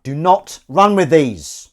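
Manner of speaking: loud, emphatic telling-off; mock stern warning. Host warning viewers about scissors, mock stern telling-off. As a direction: scold with comic outrage.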